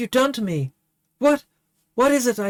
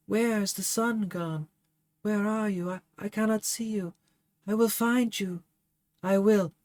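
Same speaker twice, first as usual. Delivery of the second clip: soft, higher voice slipping away; fading dying words; 4 semitones higher, 4 dB quieter than usual. Acted. Dying Favia comforting Burnett, soft and fading. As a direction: let her life ebb out gently.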